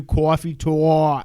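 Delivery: drawn-out New York "cawfee tawk"; Coffee Talk accent. Acted. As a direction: stretch the vowels like a New Yorker. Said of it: "I assume that's why he sent it in".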